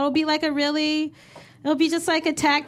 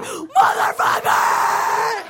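mock yell; 8 semitones higher, much slower than usual. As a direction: bellow the curse instead of complaining. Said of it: "we're just like".

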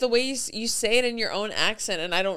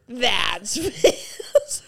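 laughing; 2 semitones higher, 6 dB louder than usual. Retold or imitated. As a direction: laugh through the quote, a bit louder. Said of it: "I'll be like".

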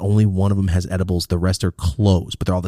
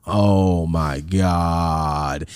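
stretched out, very slow and defeated; slow dismayed groan; 4 semitones lower, much slower than usual. Retold or imitated. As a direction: drag it out in dismay. Dismay as weed dumped.

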